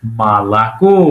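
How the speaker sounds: "মালাকো" with both first vowels as open "a", low, ending on a rising "ko"; O read as A. Shown as a demonstration. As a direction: say "মালাকো" as ma-la-KO, first two o's turned to a. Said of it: "আ হিসেবে পড়তে হবে".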